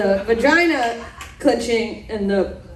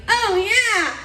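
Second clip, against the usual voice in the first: mock excitement; 10 semitones higher than usual. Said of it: "'cause you're like".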